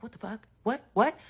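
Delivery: very soft, short astonished questions; hushed disbelief. Retold or imitated. Child secretly tuned in to mom's call, hushed disbelief. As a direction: say it under your breath, barely audible. Child listening in, muttering to self.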